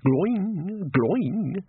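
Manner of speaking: drawn-out, wavering "gloing"; slow wobbly boing of a knock on the head; imitated underwater ringing. A narrator's reading. Wizard's ears ring underwater after a blow, wobbly ringing.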